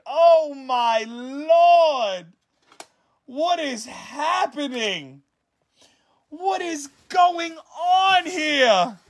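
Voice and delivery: high, slow, drawn out awe; stunned disbelief. Real, not acted. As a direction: gasp it out, overwhelmed. Stunned over card pull.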